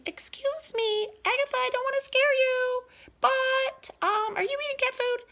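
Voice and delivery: high, fast, sugary-sweet polite voice; mock a gentle granny who would never scold; teacher hamming up a sweet granny that Granny isn't; overly polite Granny. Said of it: "No, Granny does not".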